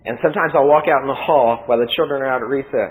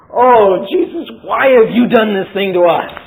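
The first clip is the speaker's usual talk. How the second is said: scolding mother; 5 semitones higher, 5 dB louder than usual. Acted. Mary scolding her found son.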